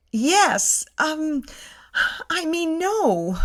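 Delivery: a lightly voiced dizzy muddle. Narrator answering Sandy while about to faint, dizzy. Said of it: "I say, dizzy".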